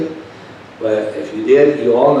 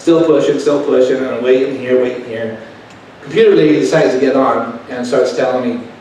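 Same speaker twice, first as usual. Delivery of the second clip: ordinary talk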